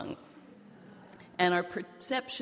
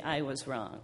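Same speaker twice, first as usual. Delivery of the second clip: usual speaking voice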